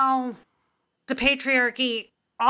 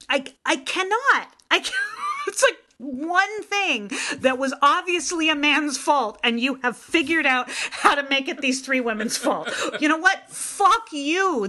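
outraged rant; 5 semitones higher than usual. Real, not acted. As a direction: vent your fury at it. Ranting outrage at documentary.

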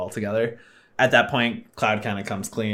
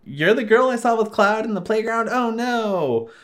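Tifa voice; 11 semitones higher than usual. Retold or imitated. Tifa voiced in retold scene.

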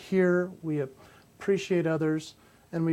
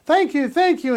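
higher, louder little dog thanks; grateful pug voice; 8 semitones higher, 6 dB louder than usual. Retold or imitated. Rescued pug thanking the man, lightly voiced higher dog. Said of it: "He goes".

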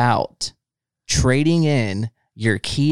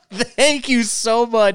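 laughing thanks, much higher; 10 semitones higher, slower than usual. Real, not acted. Host laughing as he thanks Chad for the song. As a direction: giggle out the thanks.

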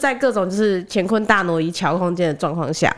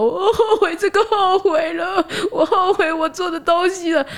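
put-on high, slow, crying kid voice; sobbing child; 10 semitones higher, slower than usual. Toddler cries to mom, high and slow. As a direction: sob it slowly like a confused toddler.

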